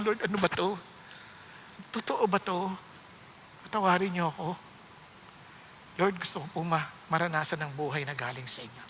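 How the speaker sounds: low, soft plea, trailing down; retold tearful prayer. Preacher recalls praying after the Word moved him to tears, hushed and low. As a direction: recall a humbled prayer without real sobbing. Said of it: "naiyak na ako".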